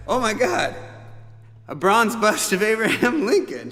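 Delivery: half-joking shocked reaction to the gift; stunned. Retold or imitated. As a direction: gasp it out in disbelief. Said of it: "And I was like".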